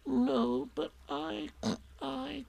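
tiny, high, faltering confession, fading away; Piglet. A narrator's reading. Piglet admits bursting Eeyore's balloon, small and faltering.

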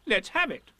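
high, loud, brisk urging; bossy Rabbit. A narrator's reading. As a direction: egg them on. Rabbit urges Pooh on.

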